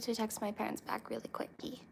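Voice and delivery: fast unvoiced whisper aside. Real, not acted. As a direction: whisper it quickly while texting. Aside while texting parents.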